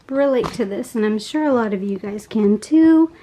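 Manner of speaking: ordinary delivery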